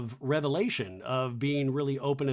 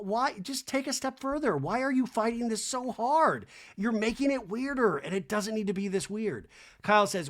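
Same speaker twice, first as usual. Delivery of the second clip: normal talking voice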